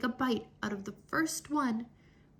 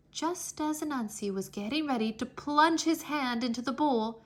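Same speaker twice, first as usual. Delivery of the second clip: plain reading voice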